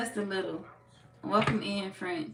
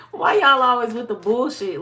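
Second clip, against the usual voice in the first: laughing; 6 semitones higher, 12 dB louder than usual. Real, not acted. Laughing at Leo's attitude.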